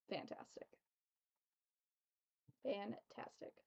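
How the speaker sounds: whispered savoring. Real, not acted. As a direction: breathe it out dreamily. Savoring food.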